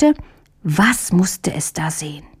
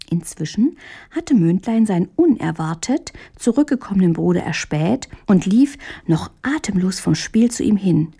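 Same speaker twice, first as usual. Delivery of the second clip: usual speaking voice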